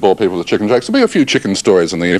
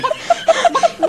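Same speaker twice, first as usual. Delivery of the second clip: laugh. Real, not acted. Laughing at sticky hands.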